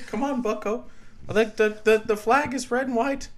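earnest, aggrieved professor impression; Jordan Peterson. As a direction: rant like an indignant lecturing academic. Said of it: "I got the Peterson".

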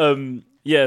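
ordinary delivery

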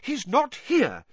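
high, slow, clipped anger; angrily. A narrator's reading. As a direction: bite the words back at the minister. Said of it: "said Missus Weasley angrily".

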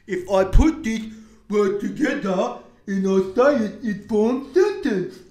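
loud, high, silly mocking voice; slow, exaggerated dopey imitation; mock dimwit. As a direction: imitate an idiot piecing words together. Mocks people stringing buzzwords together.

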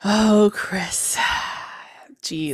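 breathy exasperated whisper. Real, not acted. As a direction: sigh his name in a whisper. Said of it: "reacting in whisper".

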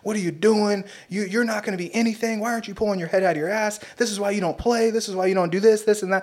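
high, fast berating rant; coach cussing out. Retold or imitated. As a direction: rip into him like a furious coach. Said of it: "just like".

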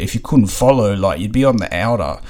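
ordinary talk, nothing marked

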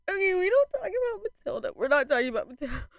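high-pitched, flustered refusal; upset, near sobbing. Real, not acted. Fan refusing to discuss the song Matilda, upset. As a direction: push it out high, then hurry the repeat. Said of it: "actually made me sob".

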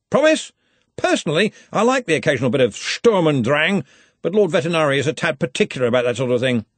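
high, loud, enthusiastic telling; hearty old boss in full flow; jovial Arch-Chancellor. A narrator's reading. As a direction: relish it with booming joviality.

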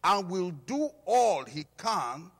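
loud, high, driving sermon voice; preaching at full force. Acted. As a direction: preach it out to the whole church. Preacher builds again.

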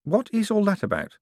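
high, baffled question; bewildered. Acted. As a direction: ask it completely lost. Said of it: "said Moyes, bewildered".

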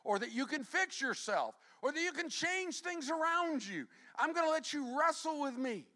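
high, loud impassioned preaching; preaching at full force. Acted. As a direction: drive it home with fire. God's words, preached loud.

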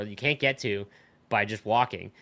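ordinary delivery

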